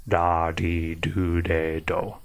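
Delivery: da di do de do with a soft tapped D; soft D syllables. Shown as a demonstration. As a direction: tap each syllable lightly like a soft D. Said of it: "try to make the sounds".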